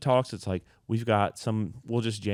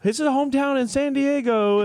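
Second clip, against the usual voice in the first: very high, loud announcer hype; mock MC shout, held high; band introduction; 6 dB louder than usual. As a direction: call it out to a cheering crowd. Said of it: "during the band intro".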